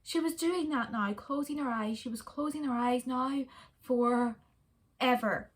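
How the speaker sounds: plain reading voice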